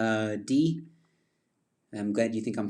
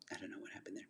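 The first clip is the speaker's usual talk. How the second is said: whisper; 3 semitones lower, 20 dB quieter, much faster than usual. Real, not acted. Whispered aside after his outburst.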